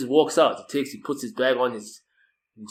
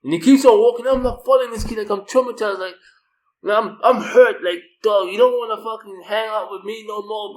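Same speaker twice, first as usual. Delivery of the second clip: higher and louder wounded rant; hurt, worked-up ranting; 9 semitones higher, 6 dB louder than usual. Real, not acted. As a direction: vent the hurt, voice pitched up. Telling how hurt he felt.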